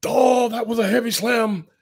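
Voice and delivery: high, hyped play-by-play call; wrestling commentator voice. Retold or imitated. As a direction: call the slam like a ringside announcer. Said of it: "a wrestling commentator's voice".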